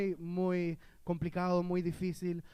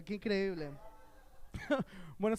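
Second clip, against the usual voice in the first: usual speaking voice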